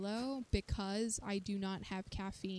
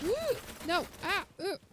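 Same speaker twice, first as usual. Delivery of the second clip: high, loud startled squeals; panicked yelps; 9 semitones higher, 7 dB louder, slower than usual. Real, not acted. Player stuck in mud under fire, panicked yelps. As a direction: yelp in alarm.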